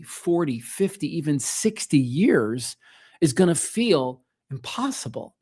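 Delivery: ordinary talk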